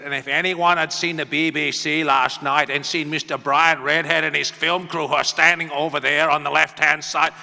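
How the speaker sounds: loud, forceful impression of a Northern Irish speechmaker; Ian Paisley. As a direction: thunder it out like calling someone out mid-speech. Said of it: "Paisley turned around and he said".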